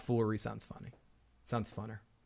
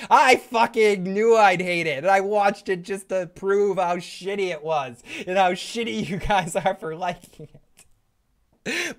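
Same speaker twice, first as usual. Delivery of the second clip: loud, high mock tirade; Joe; 5 semitones higher, 4 dB louder than usual. Fake guest blasting fans of the show, mock furious. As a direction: stretch the opening into a hammed-up angry whine. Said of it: "rest of this show with Joe".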